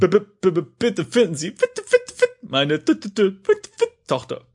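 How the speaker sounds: stuttering, jumping up like a stuck record; words catching like a scratched vinyl; skipping record. Acted. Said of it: "Seine Stimme klingt wie eine zerkratzte Schallplatte".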